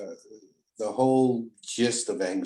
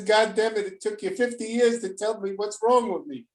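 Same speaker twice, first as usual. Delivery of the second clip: put-on high, amused exclamation from an old friend; excited old friend; 12 semitones higher than usual. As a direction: play the friend who has just been caught out, with a high pitch. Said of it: "He says".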